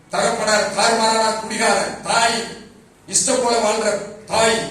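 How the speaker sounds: loud and slow, hammering each charge; forceful preaching. Real, not acted. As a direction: thunder it from the pulpit.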